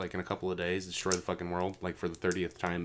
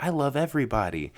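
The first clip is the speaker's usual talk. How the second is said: mock dumb happy voice; 6 semitones higher, 5 dB louder than usual. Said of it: "You're like".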